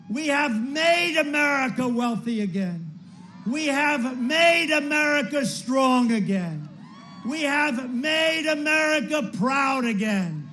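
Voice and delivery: rousing campaign cadence; rally speech. Real, not acted. Closing chant of rally speech.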